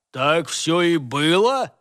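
ordinary delivery, nothing marked

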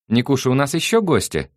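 high, loud surprised call from the hall. A narrator's reading. Mother calling from corridor, surprised. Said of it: "удивилась Наталья Александровна".

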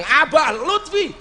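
high, drawn-out proud declaration; loud, clear answer. Acted. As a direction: announce the name slowly and boldly. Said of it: "Saya jawab dengan lantang".

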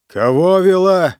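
sleepy bark, loud and slow; rudely awakened. Acted. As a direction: snap at him, half asleep, loud. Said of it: "спросонья рявкнул Ранситер".

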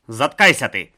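growled order. Acted. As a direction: bark it at him. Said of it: "гаркнув той другий чолов'яга".

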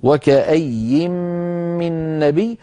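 "وكأي" read slowly with a rising end, joined to "من نبي". Shown as a demonstration. Teacher shows joined reading: slow, rising "وكأي" flowing into "من نبي". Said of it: "فنلفظه وصلا".

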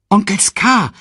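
put-on high, loud child's call. A narrator's reading. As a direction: call to your uncle excitedly. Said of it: "rief Simba".

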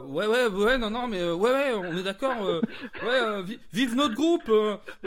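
high, loud eager yes-men; eager servile voice. Acted. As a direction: overeager henchmen, loud and high. Surrendered men eager to please. (hommes soumis, zélés et criards)

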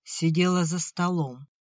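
usual speaking voice

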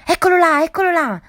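sudden frightened cry; terrified shout. Acted. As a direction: yell it out in terror, pointing. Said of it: "gridò Mac".

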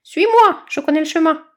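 high, loud hopping chick voice; bouncy bird chick. Acted. Chick eagerly offering to show badger the way, high and loud.

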